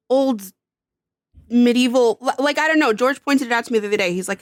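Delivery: ordinary talk, nothing marked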